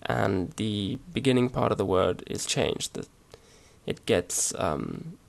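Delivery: ordinary delivery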